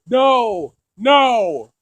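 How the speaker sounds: usual speaking voice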